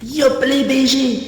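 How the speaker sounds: slow high intro voice. Pretend. Stream intro greeting.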